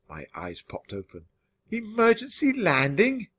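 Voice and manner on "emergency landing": alarmed. Acted. Hero hears emergency landing.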